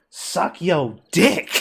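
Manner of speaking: slow, loud, drawn-out mock read-aloud; retold shout, slowed, half laughing. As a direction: read it out like the teacher announcing it to the class. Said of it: "about as loud a voice as I think she could produce".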